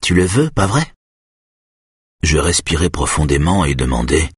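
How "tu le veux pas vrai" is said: lightly taunting, higher-pitched question; mocking. A narrator's reading. Patient provoking her therapist over an elephant, lightly mocking. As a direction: needle him with a mildly mocking question. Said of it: "d'un ton narquois".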